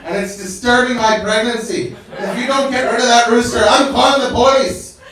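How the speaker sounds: high, loud, laughing shout; hammed-up furious neighbor; angry pregnant neighbor. Pregnant neighbor threatening police, loud high rant. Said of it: "She's like".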